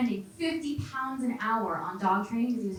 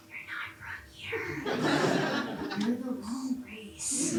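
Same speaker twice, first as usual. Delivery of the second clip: soft, whispery, grave delivery; hushed parental confession; 4 dB quieter, slower than usual. Acted. Liberal parents sitting their child down, hushed. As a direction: break awkward news in a near whisper.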